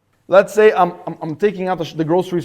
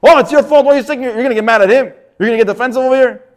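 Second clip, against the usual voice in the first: loud, higher, accusing retort; mock angry blame; 5 semitones higher, 5 dB louder, faster than usual. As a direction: snap back defensively at the victim. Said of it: "what is he gonna say?".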